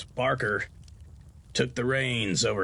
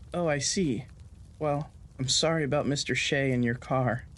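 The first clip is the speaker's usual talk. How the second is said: a higher, gentle voice for the nurse Clarita; 3 semitones higher than usual. A narrator's reading. Nurse Clarita consoles Colt about his loss and car, higher sympathetic voice.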